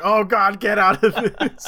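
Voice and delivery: laughing, high and loud. Retold or imitated. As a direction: cry it out through laughter. Retold reaction, laughing loudly.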